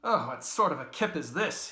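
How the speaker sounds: high, loud peevish complaint; sudden pet. A narrator's reading. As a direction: sulk out loud. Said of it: "in a sudden pet".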